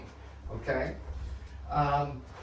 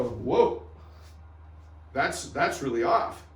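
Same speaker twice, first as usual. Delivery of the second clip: mock surprise; slower than usual. Shown as a demonstration. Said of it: "And I might go".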